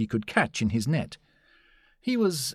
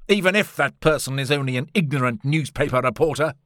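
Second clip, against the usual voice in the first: louder, higher blustering professor; overbearing scientist, pitched up; Challenger's voice; 4 semitones higher, 5 dB louder than usual. A narrator's reading. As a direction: invite forcefully, with raised voice. Narrator voices Challenger inviting the reporter.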